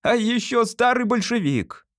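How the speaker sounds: high-pitched mocking call; shouted after him. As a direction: call it out after your departing father. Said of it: "прокричал ему вдогонку".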